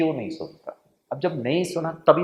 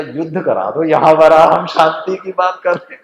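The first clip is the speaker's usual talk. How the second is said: mock protest; 3 semitones higher, 12 dB louder than usual. Joking protest to crowd.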